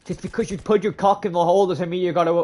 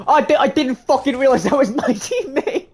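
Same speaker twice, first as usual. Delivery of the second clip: loud, high disbelieving rant with a laugh; laughing outburst; 11 semitones higher, 5 dB louder than usual. Real, not acted. Streamer laughing at his own mistake, loud and high. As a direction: spill it out loud, laughing at yourself. Realizes he got shot by enemy.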